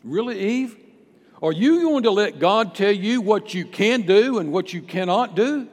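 loud, high-pitched tempter's challenge; incredulous goading. Acted. Satan goading Eve into defiance.

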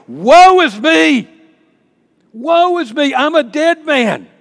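loud, high, drawn-out wail of doom; screamed cry of woe. Acted. Isaiah seeing the Almighty, acted screaming cry of woe. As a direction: cry out as if doomed, at full voice. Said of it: "Isaiah cried out, shouted, screamed".

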